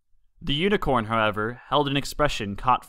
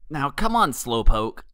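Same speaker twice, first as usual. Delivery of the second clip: playful jeer; 2 semitones higher, 3 dB louder than usual. A narrator's reading. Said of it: "playfully jeered".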